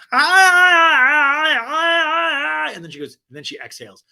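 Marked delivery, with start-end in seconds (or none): 0.1-2.7 high, loud, strained wailing ah sounds; mock wailing healer. Doctor Lonnie at the therapy session, hammed-up high wailing cries. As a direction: wail out a fake healing cry, high and loud. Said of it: "We cut back to Doctor Lonnie".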